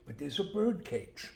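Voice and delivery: ordinary talk, nothing marked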